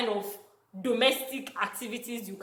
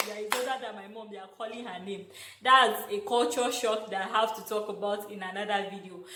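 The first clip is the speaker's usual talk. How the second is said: ordinary delivery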